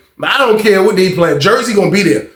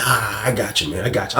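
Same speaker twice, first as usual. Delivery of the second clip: low, very soft giving-in; quietly placate, pitched way down; meek agreement; 9 semitones lower, 10 dB quieter than usual. Retold or imitated. Husband yielding to his wife's warning, meek and quiet.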